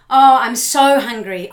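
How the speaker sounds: loud, slow whiny moan; complaining before the reframe; moaning complaint. Pretend. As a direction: groan with hunger.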